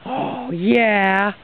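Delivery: usual speaking voice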